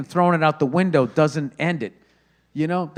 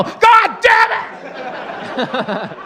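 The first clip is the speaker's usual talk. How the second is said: high, loud furious yelling; furious fed agent; 6 dB louder than usual. Retold or imitated. Fed agent yelling over lost work, furious. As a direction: explode like an agent losing months of work.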